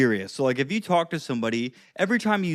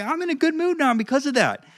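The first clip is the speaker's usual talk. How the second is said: mock cheerful; 9 semitones higher than usual. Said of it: "I was like".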